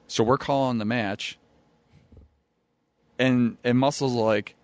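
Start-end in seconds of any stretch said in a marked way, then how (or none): none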